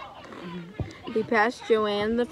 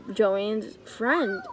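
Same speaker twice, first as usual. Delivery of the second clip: ordinary delivery, nothing marked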